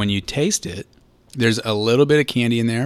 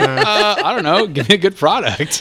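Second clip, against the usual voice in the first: high, loud laughing put-on voice; high whiny voice through laughter; mock whiny drinker; 10 semitones higher, 5 dB louder than usual. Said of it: "It's like".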